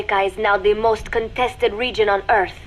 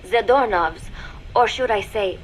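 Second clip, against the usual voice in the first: ordinary talk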